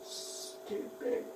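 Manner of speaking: whispered self-reproach. Real, not acted. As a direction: breathe it out under your breath. Woman mutters to herself.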